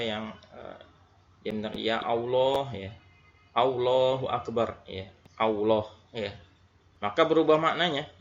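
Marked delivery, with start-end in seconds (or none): 1.8-6.3 "Allahu Akbar" said as "Aulohu Akbar", with a W in place of the L; lam turned to waw. Shown as a demonstration. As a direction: swap the L in "Allah" for a W. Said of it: "Jadi begini bacanya".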